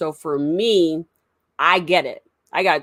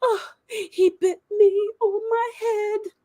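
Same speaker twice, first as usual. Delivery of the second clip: put-on high, slow, tearful little-boy voice; crying little son; slower than usual. Little son runs to dad after the dog game, high teary whimper. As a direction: whimper it like a small hurt child. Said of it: "he's like".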